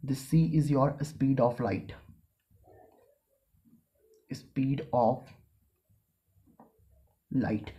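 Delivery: very slow, one term at a time; teacher dictating the formula. Shown as a demonstration. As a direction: spell the equation out for note-taking. Dictating a definition.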